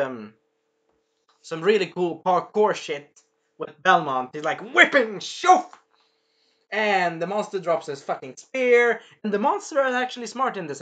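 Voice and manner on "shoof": high, loud imitated whoosh; whip sound effect. Teller acts out Belmont whipping, high loud whoosh. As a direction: voice the whip cracking through air.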